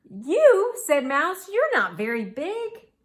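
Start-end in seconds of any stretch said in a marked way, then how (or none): 0.1-0.7 very high, loud exclamation; astonished. A narrator's reading. Mouse's astonishment at tiny monster. 1.5-2.9 high, louder mouse disbelief; incredulous. A narrator's reading. Mouse doubting the tiny monster.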